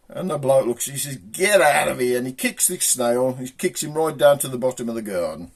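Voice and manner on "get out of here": loud, drawn-out shout; acted irritated yell; angry dismissal. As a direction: send the pest packing. Bloke angrily kicks the snail away.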